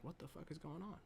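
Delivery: very soft, almost breathed-out confusion; hushed quote. Retold or imitated. Tess seeing a light come on, hushed. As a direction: drop way down as if afraid to be heard. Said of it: "She's like".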